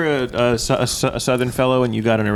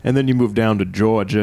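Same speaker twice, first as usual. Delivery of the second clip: usual speaking voice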